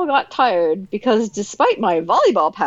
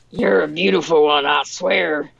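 rough voice; 5 semitones lower than usual. Retold or imitated. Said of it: "my voice rough".

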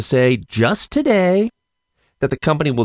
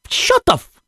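exasperated yelp. Real, not acted. Exasperated outburst, cut off.

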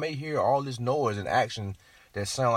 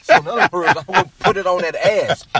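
loud teasing; 8 semitones higher, 11 dB louder than usual. Real, not acted.

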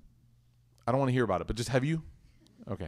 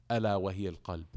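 recitation; 7 semitones lower, 6 dB quieter, much faster than usual. Acted. Reciting hadith in Arabic.

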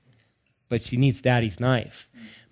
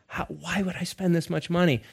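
hushed inner panic, lightly acted; mock freaking out; 3 semitones higher, 8 dB quieter than usual. Dad panics inwardly over restaurant prices, mock freaking out. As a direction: fret under your breath, softer. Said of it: "part of me's freaking out".

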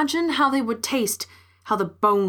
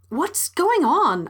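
high, drawn-out bewilderment; bewildered question; 7 semitones higher, slower than usual. A narrator's reading. Woman asks amid gathering animals, high bewildered question. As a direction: ask it slowly, pitched up in confusion.

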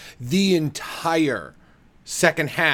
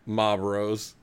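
Maine accent, R's dropped; 4 semitones lower, 5 dB quieter than usual. Shown as a demonstration. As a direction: say it low like a Mainer. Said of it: "a Maine accent".

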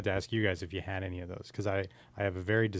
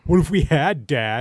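loud and higher, cheeky defiance; teen talking back to an angry father, cheeky; cheeky teen challenge; 5 semitones higher, 9 dB louder than usual. Retold or imitated. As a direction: needle your dad with the question. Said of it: "And I was like".

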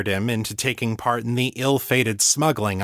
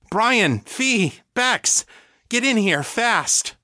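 high, raised urgent shout; calling out urgently; 12 semitones higher, slower than usual. Acted. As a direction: call the family in with urgent excitement. Daniel calls family to room.

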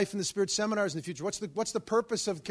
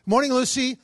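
louder, higher mock-cheery hello; hasty called greeting; 7 semitones higher, 8 dB louder than usual. Reluctant visitor opening her door, hasty called greeting. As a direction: call it through a doorway and be gone.

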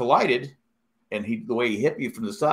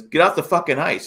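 higher, louder retold yell at a teammate; half-shouted order off the ice; goalie yelling; 7 semitones higher, 4 dB louder than usual. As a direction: bark it like a goalie who means it. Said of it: "He goes".

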